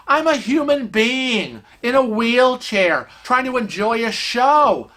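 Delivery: high, slow, emphatic protest; indignant protest. Retold or imitated. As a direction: spell it out slowly, outraged. Protests being treated as furniture.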